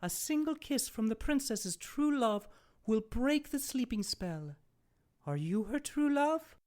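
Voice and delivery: high fairy voice, hopeful ask; fairy godmother. Acted. Fairy godmother asking the prince, high hopeful voice. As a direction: lift it into a hopeful question.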